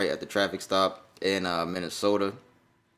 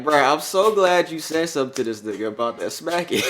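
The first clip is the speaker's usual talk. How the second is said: retelling a friend's line while laughing; laughing quote; 8 semitones higher, 7 dB louder than usual. Retold or imitated. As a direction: laugh through the quoted words. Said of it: "And he was like".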